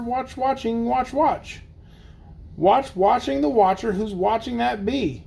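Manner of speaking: lively, sing-song storybook reading; bouncy picture-book narration; reading to children. Acted. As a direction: read the rhyme aloud for the kids. Reads Seuss aloud to kids.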